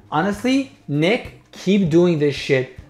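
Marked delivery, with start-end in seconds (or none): none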